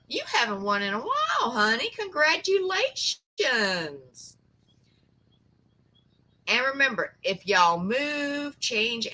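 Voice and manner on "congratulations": a high, very slow sing-song congratulations. Real, not acted. Host congratulating the winner on her live stream, slow and sing-song. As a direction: croon it out to the winner.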